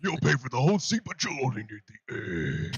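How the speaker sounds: raspy announcer hype voice; monster truck ad. Retold or imitated. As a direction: boom it like a monster truck promo. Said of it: "the announcer puts on his raspiest voice".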